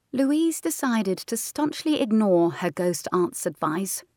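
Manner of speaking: usual speaking voice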